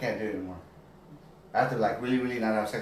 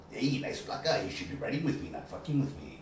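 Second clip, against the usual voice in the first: softer menacing movie-gangster voice; tough-guy quote; 8 dB quieter than usual. Retold or imitated. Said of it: "The one that goes".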